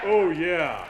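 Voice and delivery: loud, hammed-up manly oh yeah. Speaker demos the manly cheer for the guys, loud. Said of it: "nice and manly".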